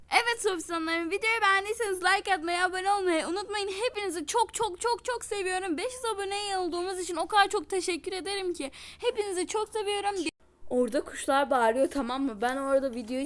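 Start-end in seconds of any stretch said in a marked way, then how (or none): 0.1-10.1 fast, rushed channel sign-off; rushed outro. Real, not acted. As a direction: rattle through the outro over birdsong. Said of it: "çok hızlı konuşmuşum". (hızlı, aceleyle kapanış konuşması)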